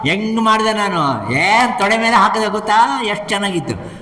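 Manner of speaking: high-pitched, animated bragging; acted gleeful boasting. Duryodhana chatting with Bhima in heaven, high gleeful boasting. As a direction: relive the deed with delight, voice up high.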